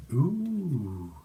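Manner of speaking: long rising and falling "ooh"; swooping, drawn-out coo; intrigued ooh. Real, not acted. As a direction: draw out an impressed ooh. Reacting to boss bitch card.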